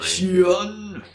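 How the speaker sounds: high squeaky name call; high character voice. Acted. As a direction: pitch it way up. Character voice in fanfic reading.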